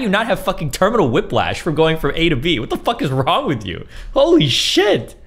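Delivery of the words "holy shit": exasperated. Real, not acted. Exasperated rant.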